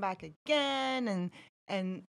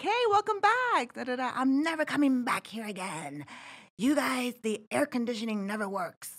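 lightly voiced complaining guest; bright welcome, then the guest's gripe; cheery front desk greeting then grumpy guest; 5 semitones higher than usual. Front desk clerk greeting a regular who gripes. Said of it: "I'll be like".

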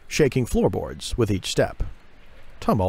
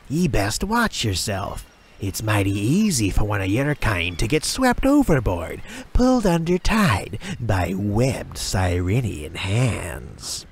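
sneering sailor. Acted. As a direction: threaten the new boy.

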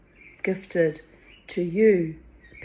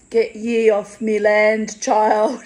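slow, loud Scottish scold, imitated; mock Scottish crone, higher and louder; Scottish old woman; 5 semitones higher, 11 dB louder, slower than usual. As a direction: growl her off the land, Scottish. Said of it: "And she'd be like".

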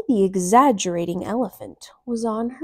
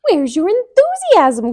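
higher, loud cry of eagerness; excited cry; 9 semitones higher, 11 dB louder than usual. A narrator's reading. Emmy crying out to her gloomy friends, loud and high. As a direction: call out full of excitement. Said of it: "cried Emmy".

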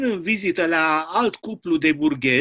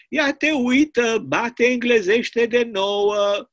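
higher, louder, slow wondering exclamation; mock amazement; 6 semitones higher, 4 dB louder, much slower than usual. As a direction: marvel at the clock. Poet remarks on the chimes.

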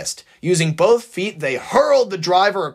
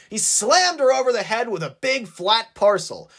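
ordinary delivery

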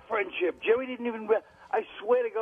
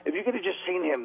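ordinary delivery

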